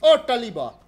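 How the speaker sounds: loud, high excited cry; excited spotting. Real, not acted. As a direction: call out the sudden sighting. Spots the goose in game.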